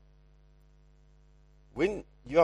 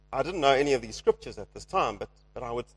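usual speaking voice

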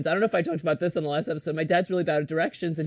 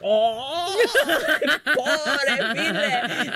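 high, laughing mock outburst; flustered dad; 7 semitones higher, much slower than usual. Daughter imitating dad after a missed exit, high mock fluster. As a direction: wail like a flustered father. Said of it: "he gets flustered".

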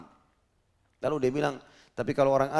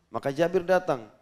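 ordinary delivery

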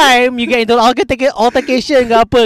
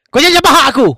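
mock tough line; 6 semitones higher, 4 dB louder, much faster than usual. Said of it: "you just say".

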